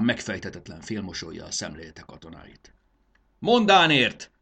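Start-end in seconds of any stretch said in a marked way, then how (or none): 3.4-4.3 high, loud rallying shout; commander's shouted war cry; battle cry. A narrator's reading. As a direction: cry it out for the whole army. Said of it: "kiáltotta a hadúr".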